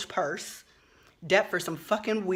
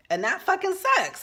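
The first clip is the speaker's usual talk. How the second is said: suddenly much higher, a furious blurt; angry outburst; 12 semitones higher than usual. Real, not acted. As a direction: let the rage burst out on sucks. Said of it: "That enrages me".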